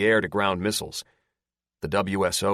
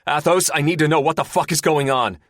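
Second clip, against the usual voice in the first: raised, demanding shout; 8 semitones higher, 6 dB louder than usual. Acted. Pilot shouts at his gunner for information, demanding. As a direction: demand answers, loud. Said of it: "Porthos shouts".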